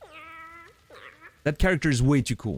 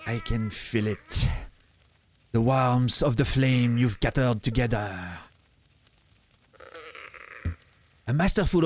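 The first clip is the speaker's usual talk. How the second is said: put-on French accent. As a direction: read it grandly with a French accent. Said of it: "French accent, right?".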